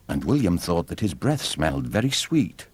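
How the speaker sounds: ordinary delivery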